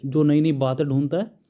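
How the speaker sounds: normal talking voice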